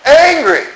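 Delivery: ANGRY flung out high and loud; Angry as a shocked exclamation; exclaimed angry. Acted. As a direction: punch the word Angry up high. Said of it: "it says he was angry".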